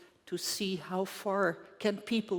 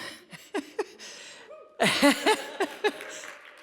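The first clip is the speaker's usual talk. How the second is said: a short, light laugh at her own age; chuckle, amused at being seventy-two; 7 semitones higher, 4 dB louder than usual. Real, not acted. Laughs after telling her age.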